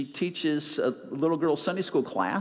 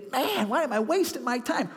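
exasperated sigh; 8 semitones higher than usual. Retold or imitated. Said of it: "you're thinking".

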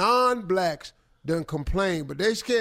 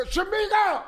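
high hollered name call; mock neighbor yell, pitched high; 12 semitones higher than usual. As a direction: holler for someone across the house. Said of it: "He yelling out".

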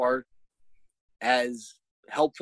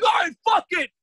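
high shouted giving-up; heated; 3 dB louder than usual. Retold or imitated. Dad giving up on the assignment, heated. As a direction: throw it off in an exasperated, pitched-up yell. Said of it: "getting fucking more and more heated".

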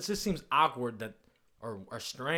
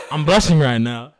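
louder, laughing it out; 15 dB louder than usual. Real, not acted. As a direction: laugh through the words.

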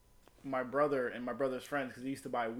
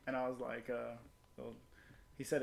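ordinary talk, nothing marked

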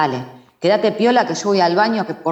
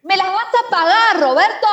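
angry threat; 10 semitones higher than usual. Acted. Sister swearing revenge.

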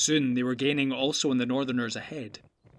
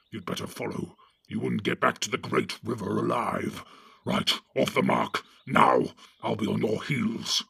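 gruff orc chief giving orders; Ugluk the orc captain; 2 semitones lower than usual. Acted. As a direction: bark orders at the cowardly Northerners. Ugluk commands.

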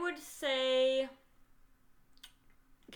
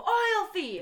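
accusing call; 9 semitones higher, 6 dB louder than usual. Retold or imitated. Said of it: "just go".

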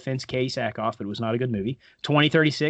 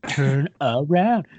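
slow teasing "Turn around" with a laugh; slow, laughing tease; teasing come-on; 4 dB louder, slower than usual. Retold or imitated. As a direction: draw out "Turn around" slowly through a chuckle. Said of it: "and she's like".

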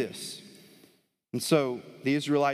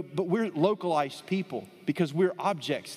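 ordinary talk

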